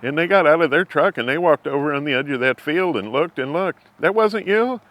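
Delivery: wife retelling. Retold or imitated. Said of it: "She said".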